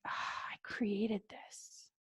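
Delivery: slow, soft whispered pride; hushed and unhurried; satisfied whisper. Shown as a demonstration. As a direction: savour it quietly. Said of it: "thinking something like".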